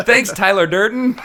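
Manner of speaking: dry mock gratitude through giggles; sarcastic thanks. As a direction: thank him with a mocking laugh.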